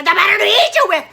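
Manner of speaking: loud, high, fast pounce; ravenous roar. Acted. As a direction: bellow it as he lunges. Wolf lunges to eat Red.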